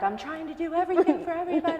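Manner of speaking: blurting out that she's overwhelmed; exasperated outburst. Retold or imitated. Wife venting at her family, fast exasperated outburst. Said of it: "and then I'm like".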